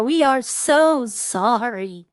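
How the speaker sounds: loud, drawn-out mock apology; imitated effusive apology. Streamer imitating French stopping by the car, loud drawn-out apology. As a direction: stretch out each word of an over-earnest apology.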